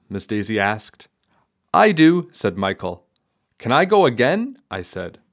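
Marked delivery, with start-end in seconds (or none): 1.7-2.3 eager. A narrator's reading. Eager kid volunteering. 3.6-4.6 eager. A narrator's reading. Eager kid asking.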